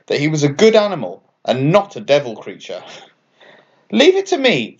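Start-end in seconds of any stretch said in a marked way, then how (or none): 3.9-4.8 high, eager promise; a touch of a keen young bat; lightly voiced eager bat; Franklin, confident. A narrator's reading. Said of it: "Franklin said".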